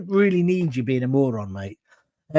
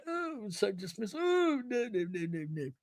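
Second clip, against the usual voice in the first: breezy, much softer sneering dismissal; dismissive; 2 semitones higher, 13 dB quieter than usual. Retold or imitated. Mocking a rich man brushing off concerns, dismissive. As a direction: wave it all away lightly. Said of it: "He can afford to be kind of".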